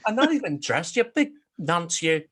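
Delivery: loud, high, outraged Northern Irish Bigfoot; Northern Irish accent. Acted. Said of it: "he's, like, Northern Irish".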